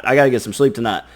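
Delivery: rushed, fast insistence; hurried repeat. Retold or imitated. Person insisting to themselves they must sleep, rushed. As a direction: hurry it out, pleading with yourself. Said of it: "you're like".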